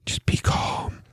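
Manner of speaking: hushed whisper to keep himself calm; a soft whispered reminder to stay calm; whispered self-calming. Pretend. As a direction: breathe out a soft whisper to settle down. Talking himself through turkey giblets.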